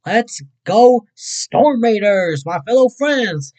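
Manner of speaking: loud, high, slow chant-like call; rally the crew like a cheer. Real, not acted. Streamer hyping his Storm Raiders fans, loud rallying cheer.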